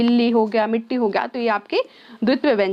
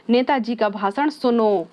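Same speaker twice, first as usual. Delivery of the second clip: सुनो drawn out long at the end, slow delivery; slow speech with a long stretched सुनो at the end; stretched last word; slower than usual. Shown as a demonstration. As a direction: hold the final सुनो for a long time, like a leader's speech. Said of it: "सुनो है यहाँ पे ना पे अत्यधिक जोर दिया गया है".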